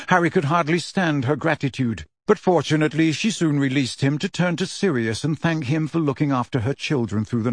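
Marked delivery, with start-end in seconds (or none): none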